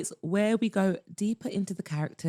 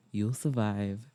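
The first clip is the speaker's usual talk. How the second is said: low-pitched man's reply; Maxwell; 11 semitones lower, 4 dB quieter than usual. Acted. Maxwell answers her teasing, low voice. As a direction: drop the register for his comeback.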